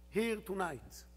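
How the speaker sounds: ordinary delivery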